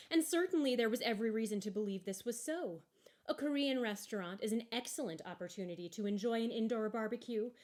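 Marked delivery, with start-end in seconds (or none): none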